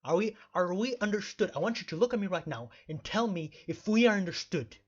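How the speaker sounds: ordinary talk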